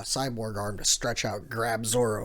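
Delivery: normal talking voice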